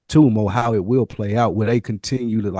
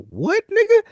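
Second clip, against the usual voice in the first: much higher, stunned squeak; shocked disbelief. Retold or imitated. Friends reacting to a boy who won't go outside, much higher in shock. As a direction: squeal it like you can't believe it. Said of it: "that just blew us away".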